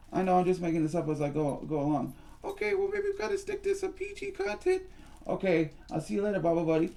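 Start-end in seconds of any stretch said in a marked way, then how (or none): 2.4-4.8 very high squeaky doll voice; high-pitched puppet Susie Q; squeaky girl puppet. Acted. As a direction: play a tiny girly doll. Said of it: "we'll call you Susie Q".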